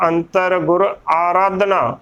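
ਆਰਾਧਨਾ opening on a full long "aa"; correct pronunciation. Shown as a demonstration. Teacher correcting the congregation's reading, careful pronunciation. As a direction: say ਆਰਾਧਨਾ as "aa-raadhna". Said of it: "ਪੂਰਾ ਬੋਲ ਕੇ".

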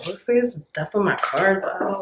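ordinary delivery

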